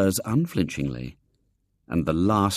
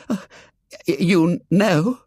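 breathless, startled stammer; gasped; 10 semitones higher, 5 dB louder than usual. A narrator's reading. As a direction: gasp it out in shock. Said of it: "he gasped".

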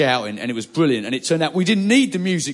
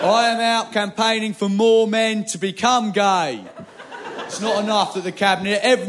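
campaigner voice; 7 semitones higher than usual. Retold or imitated. Acted campaigner pitch.

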